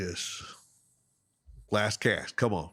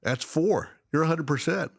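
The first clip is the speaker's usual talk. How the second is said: higher, teasing guide voice; imitating the guide; 8 semitones higher than usual. Guide ribbing the angler about the lost fish, in a higher teasing voice. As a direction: rub in the tally, mock-guide style. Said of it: "He goes".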